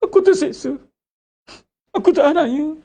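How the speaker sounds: mock lament. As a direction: wail it like a self-pitying sufferer. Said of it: "Bahasa menangis banyak".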